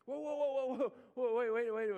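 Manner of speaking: loud, high pleading calls to stop; mock alarmed shout. Pastor calling after leaving people, hammed-up shouts. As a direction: shout after a crowd walking out.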